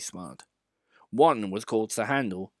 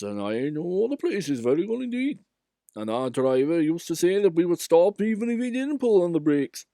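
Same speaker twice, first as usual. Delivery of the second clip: raised, lively engine voice; engine character voice; 7 semitones higher, 4 dB louder than usual. A narrator's reading. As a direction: voice the old engine. Engine voice in story.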